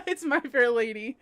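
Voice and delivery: ordinary talk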